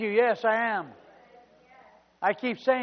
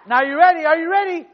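loud, high preacher's cry; shouted pulpit preaching, building; full-force preaching; 10 semitones higher, 13 dB louder than usual. Acted. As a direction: preach it out to the back row.

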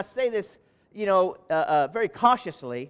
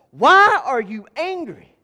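slow, louder searching question; voice of God; 5 semitones higher, 8 dB louder, slower than usual. Acted. The Lord asking Cain why he is angry, slow and loud. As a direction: ask it slow, weighty and loud. God's question quoted from scripture.

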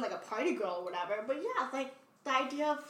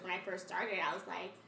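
ordinary talk

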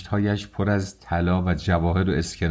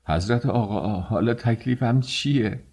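timid, anxious plea; frightened, wavering question; trembling with fear; 4 semitones higher than usual. A narrator's reading. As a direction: ask helplessly, voice rising at the end. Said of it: "با صدای لرزان پرسید".

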